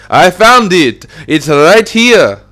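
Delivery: high, drawn-out excited yell; loud, high holler of discovery. Acted. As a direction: shout it out slowly in a put-on character voice. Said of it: "Joystick then yelled".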